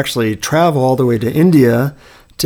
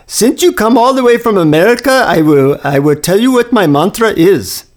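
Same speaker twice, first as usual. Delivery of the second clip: put-on Indian accent, a bit higher; Indian guru accent; 7 semitones higher than usual. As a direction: imitate the Indian guru graciously offering. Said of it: "in his deep Indian accent".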